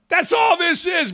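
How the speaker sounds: exasperated shout at the critics. Acted. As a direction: belt it out to hammer the point. Repeats line, now shouted.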